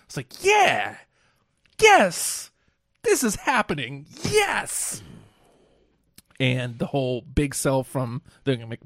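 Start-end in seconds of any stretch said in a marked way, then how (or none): 0.4-5.0 high, squealing yes, over the top; hammed-up fan joy at the big news; mock excited cheer. Imitating fans or wrestlers celebrating.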